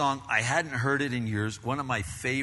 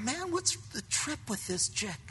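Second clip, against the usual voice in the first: high, soft mock inner grumble; 13 semitones higher, 6 dB quieter than usual. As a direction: voice the irritated thought under your breath. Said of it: "but on the inside I'm going".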